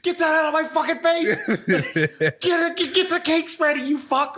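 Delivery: high, laughing mock yell; angry shout. Retelling Carmy snapping at Marcus, laughing mock shout. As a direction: bark it like a furious chef, half laughing. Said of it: "and he's like".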